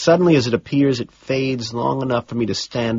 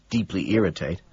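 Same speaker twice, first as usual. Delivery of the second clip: plain reading voice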